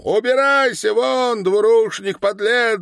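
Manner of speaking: high, loud jeers; worked-up, high-pitched yelling; crowd's angry shouting. A narrator's reading. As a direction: shout abuse as a furious mob. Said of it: "закричали ветераны".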